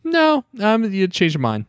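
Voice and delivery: high squeaky mock voice; mock him in a silly high voice; hammed-up sheepish backtrack; mocking fickle hero. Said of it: "and then come back and be like".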